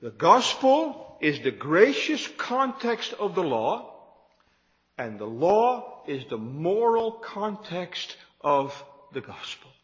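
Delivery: slowly spaced out, phrase by phrase; slow, deliberate repeat. Shown as a demonstration. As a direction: let each part of the statement sink in. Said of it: "Let me say that again".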